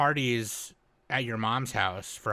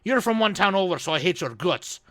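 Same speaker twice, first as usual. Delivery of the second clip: higher, louder mock-Irish grudge; hammed-up Irish townsman; 6 semitones higher, 4 dB louder than usual. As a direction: put on an Irish accent, loud and high. Mimicking feuding Irish townsfolk.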